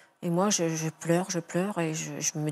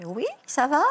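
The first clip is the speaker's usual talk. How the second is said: question enjouée; 8 semitones higher, 5 dB louder than usual. Retold or imitated. Mère répond gaiement au téléphone.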